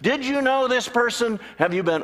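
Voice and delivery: high, eager rushing questions; lightly hammed-up eager churchgoer; excited congregant. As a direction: burst in all fired up. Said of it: "all excited".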